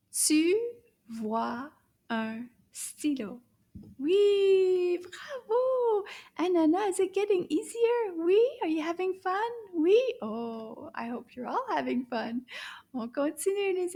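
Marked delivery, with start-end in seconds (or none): 0.1-3.4 tu vois un stylo slow and linked; slow and smooth. Shown as a demonstration. Teacher's smoother second read, slow. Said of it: "Toute la phrase au complet". 4.0-10.0 high, bright cheering voice; high excited cheer. Acted. Teacher cheering children, high and excited.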